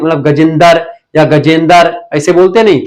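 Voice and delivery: usual speaking voice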